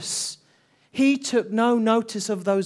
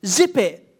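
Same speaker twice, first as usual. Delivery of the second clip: loud, higher scolding voice; scolding crowd; 5 semitones higher, 3 dB louder than usual. Retold or imitated. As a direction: tell the beggar off, impatient and dismissive. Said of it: "many voices that were calling out to him".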